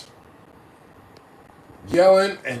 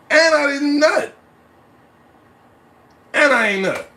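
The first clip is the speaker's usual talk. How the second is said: loud, higher outraged complaint; comic outrage; 9 semitones higher, 4 dB louder than usual. Real, not acted. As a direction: shout the punchline in mock despair.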